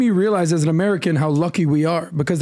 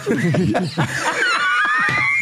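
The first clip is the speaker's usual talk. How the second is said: shrieking laugh. Real, not acted. Big laugh, shouting.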